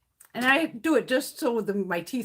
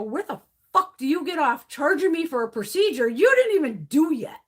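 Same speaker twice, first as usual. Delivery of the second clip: retold angry outburst; 6 semitones higher, 6 dB louder than usual. Arguing with billing office.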